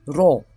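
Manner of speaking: ro with a tapped rolled R. Shown as a demonstration. Teacher shows the rolled R to learners. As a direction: roll the R in ro. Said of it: "how we're rolling our R".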